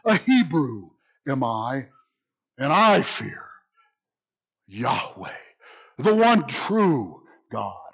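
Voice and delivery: HEBREW put first and weighted, with long pauses before "Yahweh"; slow, weighty declaration, phrase by phrase; emphatic word order. Shown as a demonstration. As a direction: lean on "Hebrew", then drop low on "Yahweh". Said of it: "the way it's emphasized".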